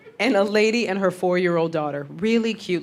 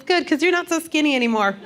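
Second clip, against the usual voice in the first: put-on higher child voice, cheerfully blunt; high innocent niece voice; blunt little girl; 7 semitones higher than usual. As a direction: kid delivering a brutal compliment. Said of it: "she goes".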